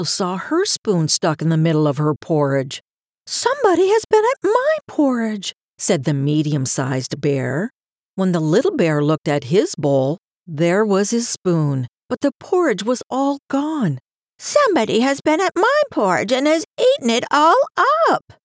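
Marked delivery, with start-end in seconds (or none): 3.3-5.5 very high, birdlike mama bear voice; chirping medium-sized bear. A narrator's reading. Medium-sized bear finding her porridge touched, chirping high. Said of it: "sounded like a little chirping bird". 14.4-18.3 high, piercing, quick little bear voice; sharp, shrill little bear. A narrator's reading. Little bear finding his porridge all gone, shrill. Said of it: "voice was so sharp and shrill".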